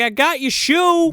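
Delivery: a lightly voiced smitten guy; mock eager doctor. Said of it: "He's like".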